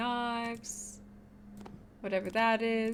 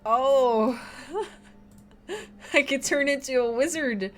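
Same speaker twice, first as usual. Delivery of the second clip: excited; 5 semitones higher, 7 dB louder than usual. Real, not acted. Delighted discovery in game.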